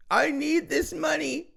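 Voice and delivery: high, slow mocking whine; hammed-up pleading voice; mock whiny beggar. Mocking the man's GoFundMe plea.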